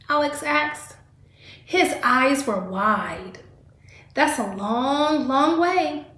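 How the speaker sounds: lively read-aloud storytelling voice; reading to children. Acted. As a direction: read the story to a class of kids. Teacher reads picture book aloud.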